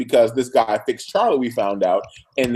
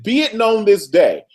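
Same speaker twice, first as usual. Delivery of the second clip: higher, slow and grand; mock-grand proclamation; epic monologue; 7 semitones higher than usual. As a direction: declaim like a stern patriarch. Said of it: "He gave him an epic monologue".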